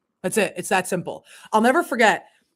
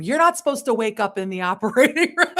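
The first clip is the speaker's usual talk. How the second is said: breaking into high laughter; laughing; 5 semitones higher, 6 dB louder than usual. Real, not acted. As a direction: laugh through the memory. Laughing at waking mid-surgery.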